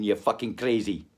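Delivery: ordinary talk, nothing marked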